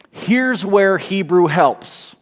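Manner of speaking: ordinary talk, nothing marked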